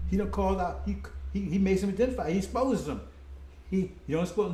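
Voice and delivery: usual speaking voice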